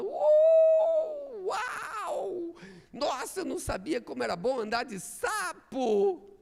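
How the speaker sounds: high joyful whoop then a laughing gush; thrilled scorpion. Acted. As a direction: squeal with delight riding the frog. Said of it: "Todo feliz".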